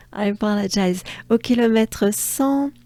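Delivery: ordinary talk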